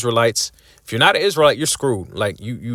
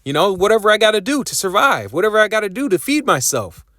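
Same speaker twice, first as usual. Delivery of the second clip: lightly voiced follower, pitched higher; compliant follower; 6 semitones higher than usual. Preacher mimics listeners accepting the chip, mock compliance. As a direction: voice someone readily going along, higher pitch. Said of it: "You say".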